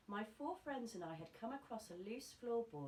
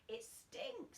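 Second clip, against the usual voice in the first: high, disgusted schoolboy call; a boy yelling his disgust; mild shout. A narrator's reading. A friend shouting about the dead mouse, high. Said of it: "someone shouted".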